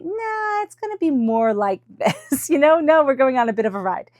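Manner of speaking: high, teasing, amused voice of Spirit; mock the plan with a laugh; playful Spirit voice. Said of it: "is Spirit going to take over and say".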